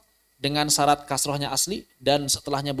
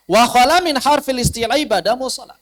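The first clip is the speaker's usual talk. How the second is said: ordinary talk, nothing marked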